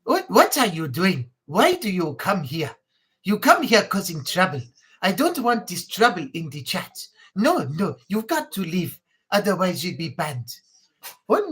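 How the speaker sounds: mock-stern African scolding; African accent. Shown as a demonstration. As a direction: put on a firm African accent, telling off a troublemaker. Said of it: "African is more like".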